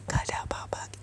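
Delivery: hushed whisper to the ex. Acted. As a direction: drop to a bare whisper. Berbisik di tengah pertengkaran. (berbisik pelan)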